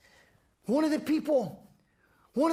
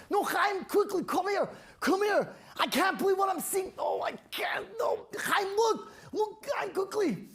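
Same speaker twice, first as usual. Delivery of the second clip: high, urgent calling to a friend; 7 semitones higher than usual. Acted. Man calling Chaim to come see, high urgent call.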